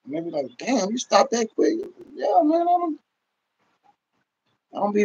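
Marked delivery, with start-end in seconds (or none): none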